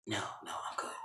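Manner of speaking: barely audible, low refusal; timid whisper. Retold or imitated. Veronica refusing Catherine's tea, timid whisper. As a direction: decline weakly in a whisper.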